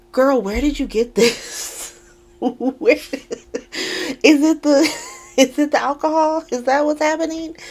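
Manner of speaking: incredulous. Real, not acted. Incredulous question to character.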